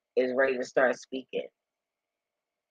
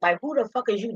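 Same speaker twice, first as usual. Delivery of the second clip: incredulous; 9 semitones higher than usual. Retold or imitated. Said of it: "And I'm like".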